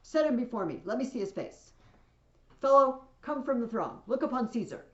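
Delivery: acted ruler giving commands; commanding orders. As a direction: summon a man out of the crowd. Caesar orders the man forward.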